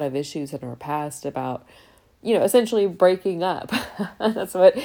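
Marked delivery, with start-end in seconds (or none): 2.2-4.7 laughing through the words, higher and louder; laughing at calling it a breakup. Real, not acted. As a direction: giggle it out. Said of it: "laughs while naming the breakup".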